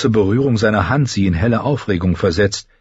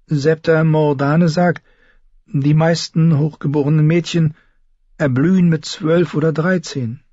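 lightly voiced timid girl; 7 semitones higher than usual. A narrator's reading. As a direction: answer the king carefully. Sansa answers timidly. (leicht angedeutetes schüchternes Mädchen)

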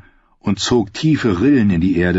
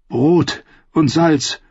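higher, slow, forced-out words; strained, halting utterance; blurted out; 8 semitones higher, much slower than usual. Acted. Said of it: "stieß Brienne hervor".

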